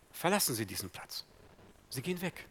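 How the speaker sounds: ordinary talk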